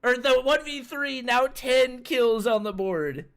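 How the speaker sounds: rising hype play-by-play, shouting the clutch; esports caster. Acted. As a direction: build up and yell the clutch call. Live clutch call, rising to shout.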